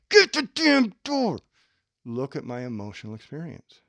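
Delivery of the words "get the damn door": loud, high, slow barked order; mock angry order. Shown as a demonstration. Ordering Mike to get the door, mock anger. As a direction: snap it out in hammed-up rage.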